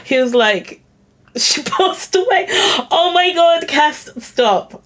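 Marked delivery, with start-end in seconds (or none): 1.4-2.5 high, laugh-broken words; awkward laughter. Real, not acted. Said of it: "this is not funny at all". 2.9-4.8 trying not to laugh. Real, not acted. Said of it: "I need a moment, guys".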